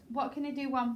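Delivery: ordinary talk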